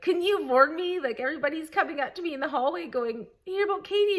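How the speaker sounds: high, animated imitation of a flustered friend; mock protest. Friend cornered in the hallway, mock protest. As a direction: act out an exasperated complaint, pitched well up.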